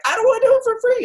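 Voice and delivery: high squeaky whine, mocking lazy people; hammed-up high whine; whiny mock voice. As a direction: mimic a whiny complainer, very high. Mocking whiny people then retorting.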